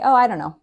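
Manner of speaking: casual "dunno" with a shrug in the voice; reduced dunno. Shown as a demonstration. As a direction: blur it into one word. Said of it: "We say".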